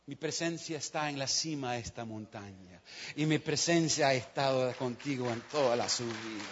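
low, soft and tender; God comforting a tired preacher; God's reassuring voice. Acted. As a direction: reassure him softly.

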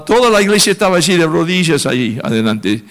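normal talking voice

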